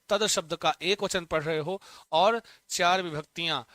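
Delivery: ordinary delivery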